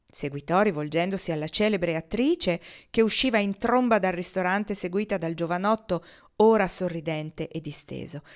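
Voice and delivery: ordinary delivery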